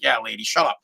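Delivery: very low, understated dismissal; quiet father. Retold or imitated. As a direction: imitate a quiet man's low aside. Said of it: "very quiet man".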